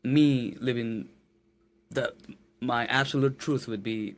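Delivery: ordinary talk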